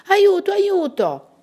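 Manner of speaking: high, loud, drawn-out call; agitated cry for help. A narrator's reading. Prisoners calling for help from the well, agitated shout. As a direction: call out urgently, pitch soaring then falling. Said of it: "voci concitate e rabbiose".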